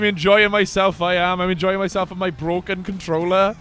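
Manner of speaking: usual speaking voice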